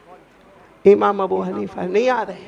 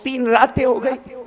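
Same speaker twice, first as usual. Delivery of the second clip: tearful confession; 6 semitones higher, 3 dB louder than usual. Acted. Abu Hanifa tells Imam Malik through tears why he must leave. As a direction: voice rising, close to sobbing. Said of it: "نے روتے روتے کہا".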